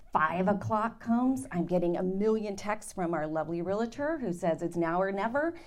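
ordinary delivery

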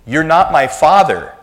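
high, loud mock declaration; loud public denial. Daughter getting back at dad, loud high proclamation. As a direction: announce it to the whole mall. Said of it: "really loud says".

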